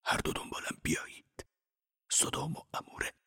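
frightened monk's secret whisper. Acted. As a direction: breathe it so no one overhears. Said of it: "زکریا زیر لب گفت".

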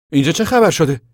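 high, forceful questioning; loud demand. A narrator's reading. Armed lawman arriving at the camp, demanding loudly.

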